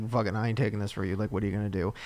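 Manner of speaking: low, soft, fast mutter; lightly voiced grumpy dog; the dog refuses the blame in a low, quick grumble; dog's voice. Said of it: "The dog is looking at me like".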